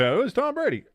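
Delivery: high mock announcer reveal; mock answer reveal. Streamer predicting the trivia answer, mock reveal. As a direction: tease the answer in a quiz-host voice. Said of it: "Watch, he's gonna be".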